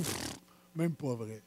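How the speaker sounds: dismissive puff, then very low and soft; muttered scoff. Retold or imitated. Preacher voicing what doubters are thinking, muttered scoff. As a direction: mimic a doubter grumbling privately.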